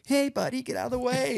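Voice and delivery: high-pitched little put-on voice; mock meek warning. Mimics what the gun was not.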